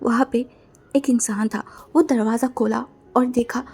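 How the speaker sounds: usual speaking voice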